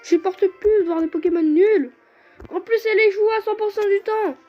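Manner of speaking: raging gamer. Real, not acted. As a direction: rant at the useless Pokémon. Outraged at useless Skitty.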